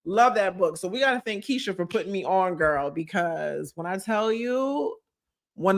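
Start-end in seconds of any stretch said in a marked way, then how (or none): none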